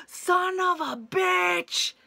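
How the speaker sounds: jolted awake, the intruder is inside; startled gasp-curse. Retold or imitated. Gasp, hears screen door open.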